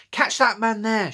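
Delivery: loud, high urgent call; cried out. A narrator's reading. Girl on stage alerting the crowd, loud high cry. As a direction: cry out to the whole hall. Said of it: "she cried".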